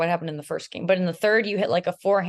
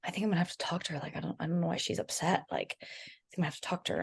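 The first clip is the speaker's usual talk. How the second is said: quiet worried aside; 9 dB quieter, faster than usual. Retold or imitated. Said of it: "And James is like".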